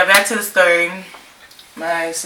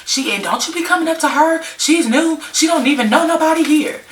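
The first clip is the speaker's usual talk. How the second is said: imitating the friend, pitched higher; mock-fierce defending the new girl; protective friend warning off; 11 semitones higher than usual. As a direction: friend telling the girl to back off, in a high mock-scolding voice. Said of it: "she's just like".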